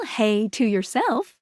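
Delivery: ordinary talk, nothing marked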